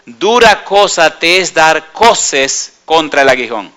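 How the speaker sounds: slow and weighty, every word measured; solemn slow quote. Acted. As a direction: lay the phrase down slowly and solemnly. Quoting Jesus's key phrase. (cita lenta y solemne)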